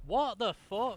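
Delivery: high, loud, disbelieving shout; loud shocked outburst. Real, not acted. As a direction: blurt it out in outrage. Bullets stop hitting.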